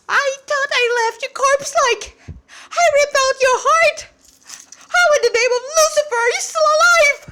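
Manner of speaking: high, loud, screeching demon shriek, fast at the end; furious demon roar. Acted. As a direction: shriek it like an enraged demon who can't believe his eyes. Demon shocked to see boy alive.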